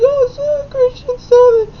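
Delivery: put-on very high, slow, wailing child; whiny tearful tattle; mock crying little girl. Crying girl tells the teacher, very high and slow. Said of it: "the girl's crying".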